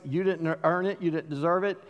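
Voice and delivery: ordinary talk, nothing marked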